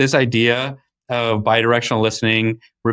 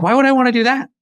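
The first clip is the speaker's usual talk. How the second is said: indignant; 11 semitones higher, faster than usual. Retold or imitated. PM balking at note taking.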